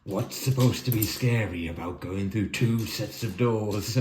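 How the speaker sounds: slow, eerie mock-sinister delivery; lightly spooky, drawn-out question. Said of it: "he sort of spooky voice".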